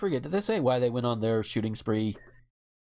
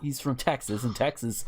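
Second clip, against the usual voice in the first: ordinary delivery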